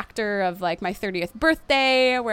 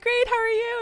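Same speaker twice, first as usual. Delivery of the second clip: very high, forced-cheerful reply; put-on chirpy cover-up; brave face; 14 semitones higher than usual. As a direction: act fine while close to tears. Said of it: "you put on a brave face".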